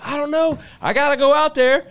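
high, loud alarmed blurt; startled retold shout. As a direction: blurt it out in a jolted panic. Startled, calling to wife at night.

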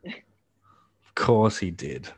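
very slow, dragged-out words; slow drawn-out. Real, not acted. Friend agreeing about the mullet kid, slowly drawn out. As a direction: stretch each word out in disbelief. Repeats it, second time drawn out.

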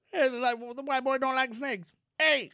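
loud, high mock Cajun; Cajun impression. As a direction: call out like a comic Cajun. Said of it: "You should speak like that at all times".

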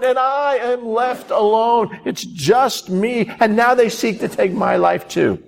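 a hammed-up self-pitying complaint; whiny Elijah. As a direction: whine it like a sulking man. Preacher voices Elijah's self-pity.

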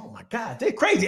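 high, startled mock exclaim; mock disbelief. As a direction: recoil at the crazy runners. Reacting to runners chanting.

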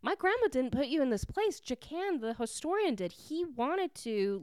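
confused protest. Retold or imitated. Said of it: "Grace is so confused".

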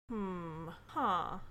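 quiet, noncommittal murmur; softly withholding any enthusiasm, put on; mock unimpressed. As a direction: imitate a friend's underwhelmed reaction. Imitating friend's underwhelmed reaction to news.

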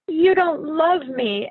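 put-on loud, slow, sulky cry of a child; sulky child outburst. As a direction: stomp it out like a hurt kid. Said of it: "stomps off".

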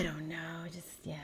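whisper. Real, not acted. Glum mood, drops to whisper.

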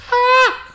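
sudden very high, loud shriek; short piercing scream, mimicking the dog; imitated dog scream. Showing the co-host how their dog screams, piercing shriek. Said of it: "She actually screams".

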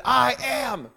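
high, loud, ringing proclamation; big preacher's voice of God; thundering divine declaration. Acted. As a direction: proclaim it as God's own name. Voicing God speaking to Moses.

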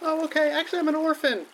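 put-on high small child voice. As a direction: confess like a sad kid. Drowning child.

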